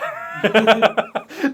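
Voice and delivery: high, loud burst of laughter. Real, not acted. Game master amused by player's lie, loud laughing. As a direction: crack up out loud.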